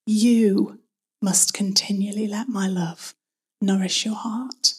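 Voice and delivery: "You" held alone before a pause; stress on YOU. Shown as a demonstration. Preacher taking the verse word by word, stress on "You". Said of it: "emphasize each word in turn".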